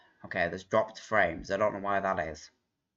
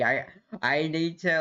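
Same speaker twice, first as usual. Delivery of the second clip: normal talking voice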